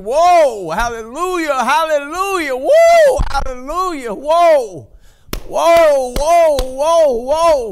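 high, loud shouted hallelujahs; real joyful worship shouts; ecstatic praise. Real, not acted. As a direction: cry out in rapture. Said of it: "I hadn't felt like that in a long time".

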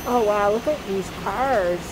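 ordinary talk, nothing marked

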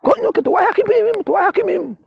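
very high falsetto imitation of a scolder; mimicked scolding. Man ordering him to come along, mimicked in falsetto. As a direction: scold in a squeaky put-on voice.

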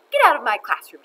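loud, forceful order; demanding. A narrator's reading. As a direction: throw the intruder out. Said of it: "she demanded".

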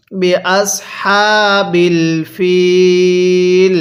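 very slow "বি আসহাবিল ফিল", long held "ফিল"; model recitation. Shown as a demonstration. Teacher models verse ending, very slow "বি আসহাবিল ফিল". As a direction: hold the "ee" of "ফিল" on a level note in "বি আসহাবিল ফিল". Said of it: "এরকমভাবে শিখব".

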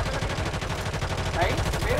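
hissed urgent look-look; urgent whispered alert; urgent pointing out. Real, not acted. Spotting enemy urgently.

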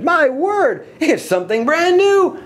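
very high, stretched exclamations; excited crowd cry, high and sustained; amazed shouting. A narrator's reading. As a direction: proclaim it in thrilled astonishment. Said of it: "they shouted".

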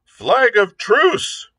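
slow, high, loud cry across the yard; cried out. A narrator's reading. Silver calls to the stockade, a slow loud cry. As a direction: stretch it out as a call from afar. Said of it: "cried Silver".

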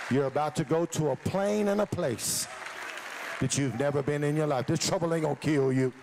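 loud, driving preacher's climax over music; full-force preaching. Acted. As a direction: whip the congregation up to their feet.

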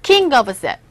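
ordinary talk

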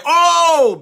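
high, loud startled oh; loud pew exclamation; congregant exclaiming. Retold or imitated. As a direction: cry out as if your toes got stepped on. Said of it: "They might say".

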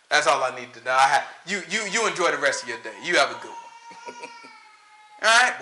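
ordinary delivery

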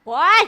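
high, loud ouch; loud cry of dismay. Real, not acted. Friends crowd in for the group shot, a loud, high yelp. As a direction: yelp out in mock pain. Reacting to a mishap.

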